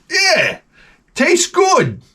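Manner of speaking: tasting voice. Retold or imitated. Tasting the imagined wine.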